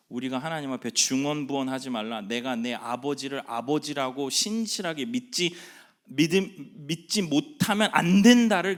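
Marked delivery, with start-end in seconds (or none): none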